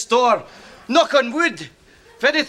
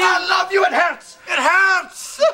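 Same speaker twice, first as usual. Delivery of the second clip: high, overwrought cry of love; hammed-up emotional outburst; overcome with emotion; 5 semitones higher than usual. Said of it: "I get so emotional".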